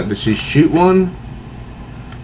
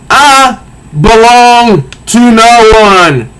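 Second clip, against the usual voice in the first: slow, high, proud heroine declaration; defiant heroine; 9 semitones higher, 4 dB louder, much slower than usual. Acted. Wonder Woman defying the villain, slow and proud.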